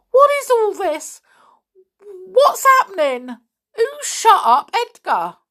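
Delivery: very high, loud questions; bewildered outburst. A narrator's reading. Mister Stick facing children and police, bewildered. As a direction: demand to know in shrieked confusion.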